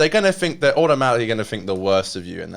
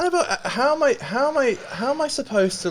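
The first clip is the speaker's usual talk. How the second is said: distressed; 13 semitones higher than usual. Real, not acted. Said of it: "felt like fucking crying".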